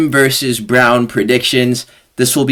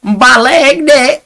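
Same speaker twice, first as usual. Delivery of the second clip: higher, slow mock Jamaican accent; put-on Jamaican accent; 12 semitones higher, 4 dB louder, much slower than usual. Imitating a girl's bad attempt, mock Jamaican accent. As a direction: drawl it like a girl faking a Jamaican accent. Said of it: "gonna do the Jamaican accent".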